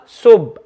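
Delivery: Sub closed on a silent b; without qalqala. Shown as a demonstration. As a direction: shut the lips on Sub, no release. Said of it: "this is without qalqala".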